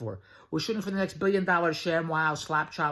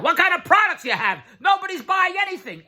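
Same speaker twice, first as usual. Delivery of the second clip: very high, louder scoffing friend; mock skeptical friend; 13 semitones higher, 8 dB louder than usual. Friend's voice in retold dialogue.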